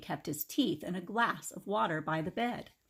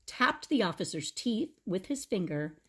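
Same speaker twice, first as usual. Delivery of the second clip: plain reading voice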